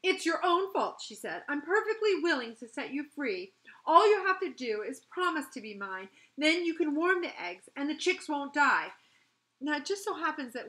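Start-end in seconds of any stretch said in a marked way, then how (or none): 0.0-1.0 loud, high-pitched scolding; indignant, raised-voice blame; cross. A narrator's reading. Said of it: "replace the queer feeling with crossness". 1.5-8.9 loud, higher, exasperated bargaining; indignant storybook fairy laying down terms; cross. A narrator's reading. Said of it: "replace the queer feeling with crossness".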